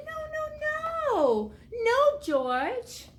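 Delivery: high, drawn-out no's of a mother; dismayed mother. A narrator's reading. Mother corrects her puppy for meowing, high-pitched dismay. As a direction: wail the no's high, then scold. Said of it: "His mother said".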